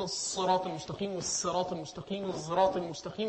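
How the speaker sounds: الصراط read three ways: with s, with sad, with a z-like sad; soft, low recitation of the three variants; three Quran readings. Shown as a demonstration. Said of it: "يعني احنا بنقول".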